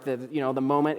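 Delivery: normal talking voice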